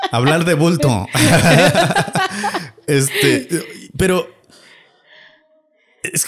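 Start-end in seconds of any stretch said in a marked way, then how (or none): 1.1-2.7 high laugh. Real, not acted.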